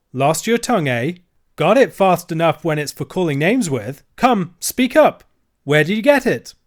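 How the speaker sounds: bullying dealer. Acted. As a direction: push the silent girl. Dealer presses the children.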